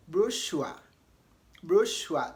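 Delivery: brochure said bro-SHOO-a, rhyming with Joshua; American brochure. Shown as a demonstration. Teacher showing students the American brochure, rhyming with Joshua. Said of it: "to rhyme with Joshua".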